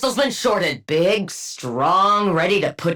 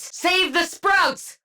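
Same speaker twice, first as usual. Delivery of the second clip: urgent call; 10 semitones higher, much slower than usual. Acted.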